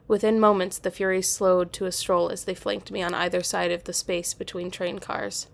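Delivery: ordinary delivery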